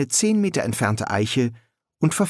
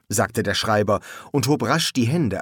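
plain reading voice

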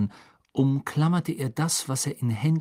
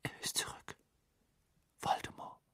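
very faint, breath-only whisper; 16 dB quieter than usual. A narrator's reading. Harry naming Voldemort to Dumbledore, whisper. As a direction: whisper it with hardly any sound. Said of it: "flüsterte Harry".

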